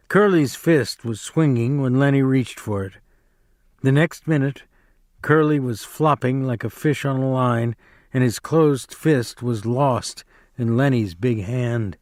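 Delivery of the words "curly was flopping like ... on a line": ordinary delivery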